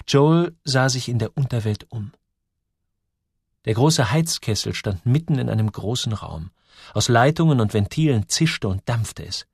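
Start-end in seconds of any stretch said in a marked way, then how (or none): none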